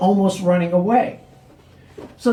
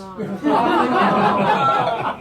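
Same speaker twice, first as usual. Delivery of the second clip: a real laugh that climbs up; rising laugh; 3 semitones higher than usual. Real, not acted. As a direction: burst out laughing at yourself. Comic laughs at own joke.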